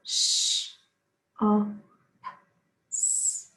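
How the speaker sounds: shops segmented into sh, o, s, with s whispered; sounding out words. Shown as a demonstration. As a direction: say each sound of shops apart. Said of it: "We can also sound out and blend shops".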